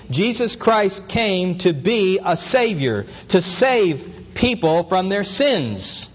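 slow, each word weighted; slow emphatic proclamation. Shown as a demonstration. Pastor proclaims the Savior to his flock, slowly. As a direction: proclaim it like the heart of the message. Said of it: "climax of teaching".

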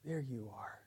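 very quiet, gentle acknowledgement; hushed, self-effacing greeting; soft humility. Acted. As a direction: say it softly, as Humility noticing others. Humility personified, repeated softly.

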